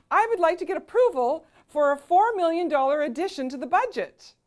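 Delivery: high, loud pitch in a serious request; too high for the board. Shown as a demonstration. Showing a board request in a voice pitched too high. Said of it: "So when I'm excited".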